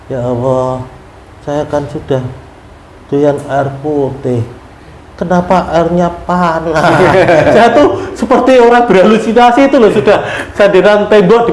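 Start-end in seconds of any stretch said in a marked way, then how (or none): 0.1-6.8 weak plea. Retold or imitated. Weak, dehydrated prayer.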